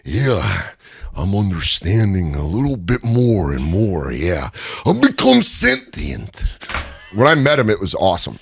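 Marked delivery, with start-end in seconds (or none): 0.0-6.4 Macho Man impression. Mock Macho Man becoming sentient, slow and raspy. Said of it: "always the grab of the".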